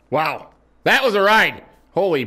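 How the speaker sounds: high, loud exclamation; exhilarated. Pretend. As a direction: marvel at surviving the ride. Amazed after the wild ride.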